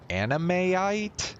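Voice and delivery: Animeite drawn out as anime-ite with a high questioning rise; puzzled pronunciation. Real, not acted. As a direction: sound out Animeite slowly, voice climbing in doubt. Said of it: "weird meshing of words".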